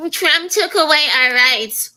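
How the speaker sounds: high, slow whiny mimicry of "Trump took away our rights"; mocking women protesters. Host mocking female protesters, in a high whiny voice. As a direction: parody the complainers with a put-on voice. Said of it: "These females that are running around talking about".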